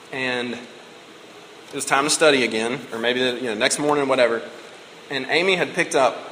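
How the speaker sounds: normal talking voice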